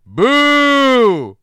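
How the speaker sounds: long, high, booming mock shout; hammed-up drawn-out villain yell; Phantasm Ravenger boo. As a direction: bellow it high and drag it out. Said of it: "Phantasm Ravenger".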